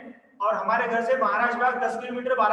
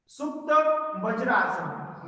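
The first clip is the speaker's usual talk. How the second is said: slow pose name; slower than usual. Shown as a demonstration.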